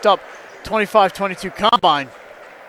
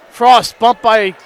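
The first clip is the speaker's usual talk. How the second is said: excited call; 4 semitones higher, 4 dB louder than usual. Real, not acted. Call of a dunk.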